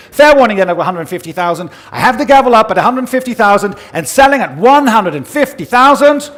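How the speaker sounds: loud, rising last warning; final call. Real, not acted. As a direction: ring out the fair warning before the hammer. Final call before the gavel.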